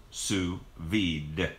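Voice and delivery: SOUS VIDE said slowly, word by word, with a sounded d; English pronunciation. Shown as a demonstration. Telling viewers how it is pronounced, English "sous vide", slow and deliberate. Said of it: "That's the way we do it in English".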